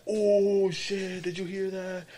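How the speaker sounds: high, stunned whisper-like gasp of gossip; shocked coworkers. Retold or imitated. Arriving coworkers overhear the fight, high mock shock. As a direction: react like a coworker who just overheard. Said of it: "they're in the background like".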